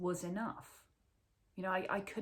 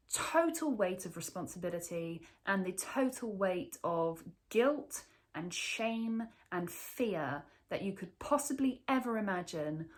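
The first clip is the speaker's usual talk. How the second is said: ordinary delivery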